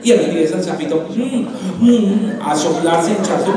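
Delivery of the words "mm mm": high, effortful "mm" grunts; straining puffing hum. Acted. Toad trying to swell into a cow, straining hums. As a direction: imitate puffing yourself up.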